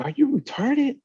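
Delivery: high-pitched retold disbelief; incredulous outburst. Retelling what he said to the coworker who took his truck, in a high incredulous voice. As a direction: jump way up in mock disbelief.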